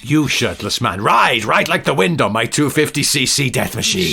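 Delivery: dramatic mock-heroic command; hammed-up villain's proclamation; villainous send-off. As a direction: urge the rider on with grand menace. Villain sends off the rider.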